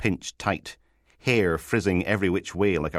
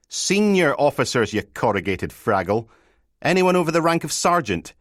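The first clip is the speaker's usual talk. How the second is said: exasperated interruption; 9 semitones higher, 6 dB louder than usual. Acted. Sergeant interrupts, insulting constable.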